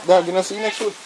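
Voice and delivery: normal talking voice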